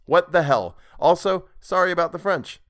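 embarrassed reader apologizing for his French; flustered outburst. Real, not acted. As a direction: blurt out apologies in a rush. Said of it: "laughing apology for bad French".

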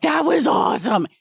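high-pitched gushing listener message; mock-excited high exclamation; excited fan. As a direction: imitate a thrilled fan, pitch leaping up.

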